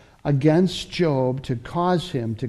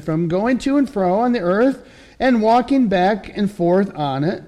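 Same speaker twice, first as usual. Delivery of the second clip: a slightly raised, lightly voiced Satan; Satan's reply; 6 semitones higher, 3 dB louder than usual. Satan answering the Lord with a light sly edge. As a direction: toss it off like a sly accuser.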